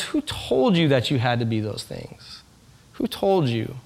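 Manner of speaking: soft tender question. Acted. Preacher voicing a loving parent to a child, soft and slow. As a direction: ask it softly, full of love.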